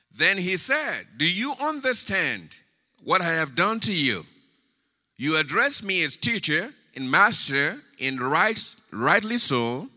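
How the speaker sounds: loud, high proclamation of Jesus' words; forceful scripture reading. Acted. Preacher reading Jesus' words to the church, forceful scripture reading. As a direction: declaim the verse to a congregation.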